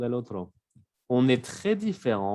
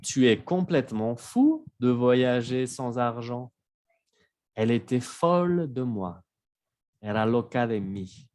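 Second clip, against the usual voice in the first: ordinary delivery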